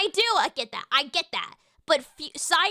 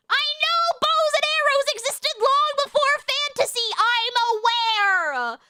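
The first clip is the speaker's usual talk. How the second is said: annoyed; 7 semitones higher than usual. Real, not acted. Annoyed reply to chat.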